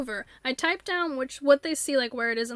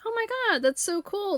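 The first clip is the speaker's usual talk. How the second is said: higher, mock-enthusiastic gush; 7 semitones higher than usual. Tutor gushing over a child's story, lightly mocked and higher. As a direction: imitate an overly keen tutor.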